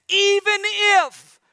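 even if drawn out high. Acted. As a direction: stretch out even, high and slow, then hold. Dramatic pause in prayer.

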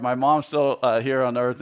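ordinary delivery

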